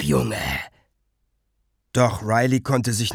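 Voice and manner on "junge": Junge gedämpft und fallend; gedämpfter Ruf. A narrator's reading. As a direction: Junge knapp und gedrückt, fast leise. Garrick drängt, noch gedämpft. (Garrick treibt Riley halblaut an)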